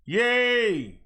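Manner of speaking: loud-ish, high, drawn-out cheer; excited cheer. Real, not acted. Vlogger seeing good news in the case numbers, excited cheer.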